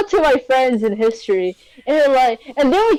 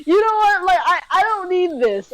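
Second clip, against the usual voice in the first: indignant dismissal; 6 semitones higher than usual. Retold or imitated. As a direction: wave them off, offended. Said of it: "I'm like".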